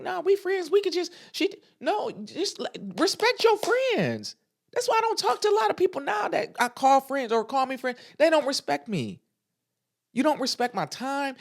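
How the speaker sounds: rising into an exasperated rant; heated rant. Real, not acted. Scolding disrespectful friends, high exasperated rant. Mocking friends then ranting.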